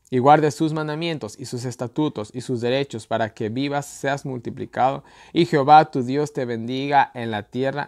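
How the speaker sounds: loud, emphatic reading of the verse, slowing at the close; scripture reading. Acted. Preacher reading God's command to viewers, loud proclamation.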